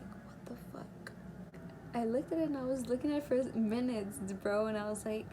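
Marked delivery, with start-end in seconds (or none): 0.0-1.1 whispered in disbelief, slow; stunned whisper. Retold or imitated. Girl alone with a positive test, stunned whisper. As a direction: breathe it out in shock.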